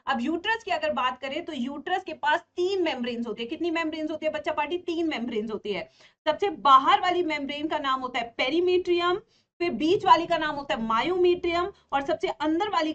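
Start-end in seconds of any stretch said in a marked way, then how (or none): none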